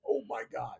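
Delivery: put-on soft, high-pitched "starstruck kid" reaction; retold awed gasp. Kid told he can call his idol, retold in high, soft awe. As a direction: act out hushed, high disbelief.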